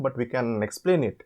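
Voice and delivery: usual speaking voice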